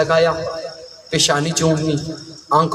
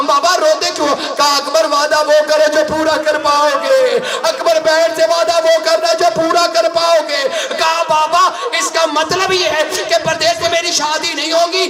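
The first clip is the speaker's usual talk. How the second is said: high, impassioned lament recitation, near shouting; preaching at full force; 12 semitones higher than usual. Acted. Preacher to mourners at a majlis, impassioned wailing lament. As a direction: pour grief into the telling of the tale.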